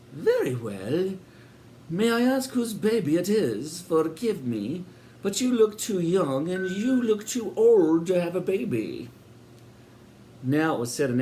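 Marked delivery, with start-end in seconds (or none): 0.1-9.1 high, sugary welcoming landlady voice; sinister hostess. A narrator's reading. Landlady welcoming Sid and Eric, high and sweet. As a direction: greet them grandly, a touch too sweet.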